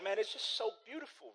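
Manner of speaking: high, lightly tearful gush; hammed-up choked-up admiration, kept light; mock crying. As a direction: pretend to be moved to tears. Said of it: "he starts to cry".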